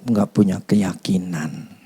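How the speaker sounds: slow hushed whisper. Retold or imitated. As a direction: drop to a whisper on the doubt. Describing doubt.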